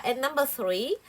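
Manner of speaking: ordinary talk, nothing marked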